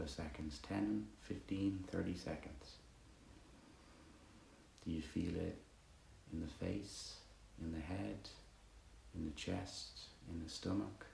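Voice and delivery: soft, unhurried meditation guidance; guided meditation. Acted. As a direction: guide them gently through body and breath, pausing between phrases.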